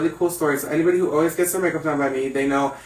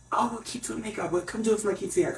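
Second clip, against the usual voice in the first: lightly voiced client; 5 semitones higher, 8 dB quieter, faster than usual. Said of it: "they said".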